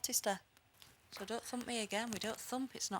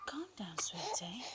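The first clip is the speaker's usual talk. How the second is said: whisper; 8 dB quieter than usual. Real, not acted. Soothing a crying baby at night.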